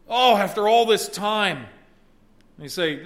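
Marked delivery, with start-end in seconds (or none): 0.1-1.6 rising joyful exclamation; joyful relief. Acted. Adam exclaiming in joy on meeting Eve. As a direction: let relief burst out.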